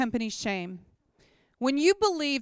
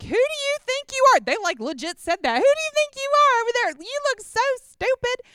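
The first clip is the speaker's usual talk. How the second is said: much higher, louder jeering taunt; mocking neighbors; 12 semitones higher, 5 dB louder than usual. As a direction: sneer at the dancing kids. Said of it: "pointing and laughing at us".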